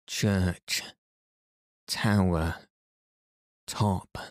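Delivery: one word at a time, long pauses; spaced-out words as if calling from afar; very slow, deliberate. A narrator's reading. Cyril replying from the church tower, very slow and deliberate. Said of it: "in his deepest voice, very slow and loud".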